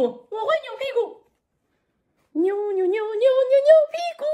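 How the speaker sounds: high, bouncy puppet voice. Acted. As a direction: chant it like an excited little puppet. Puppet Frankie wiggles.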